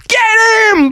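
high, loud attack shout; shouted high-pitched war cry; battle cry. Acted. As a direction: yell the order to charge. Battle cry after counting to three.

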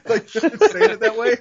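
very high, laughing voice. Real, not acted. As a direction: squeeze the words out through laughter. Still laughing at the pitch.

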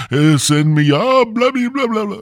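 high, held wailing gibberish; hammed-up preacher babbling in tongues; mock preacher. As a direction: wail nonsense like a frenzied preacher. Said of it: "We was talking about the preachers".

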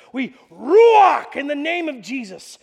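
loud, slow proclamation; fervent prayer. Acted. Preacher prays over the sick Taylors, loud and slow. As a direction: call down the healing breath.

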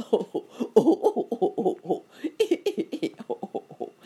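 big, loud helpless laughter; alligator laughing. A narrator's reading. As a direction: guffaw in hooting bursts, going high. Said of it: "laughed the gator".

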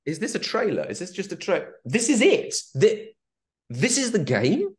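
high, rising astonished questions; realizing it's actually the game; stunned disbelief. Retold or imitated. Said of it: "I was watching it going".

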